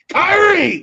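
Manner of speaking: high, loud, slow call of Kairi; yelling Kairi. Retold or imitated. Asuka calling her partner from afar, a slow loud yell. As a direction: shout her name across the distance. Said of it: "Asuka just yelling Kairi".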